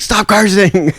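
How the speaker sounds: high, mock scolding girlfriend; imitated scolding girlfriend. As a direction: lightly voice a partner telling him off. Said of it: "She's like".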